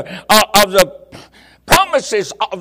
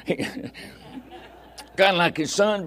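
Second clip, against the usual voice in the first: laughing; 2 semitones lower, 9 dB quieter, much slower than usual. Real, not acted.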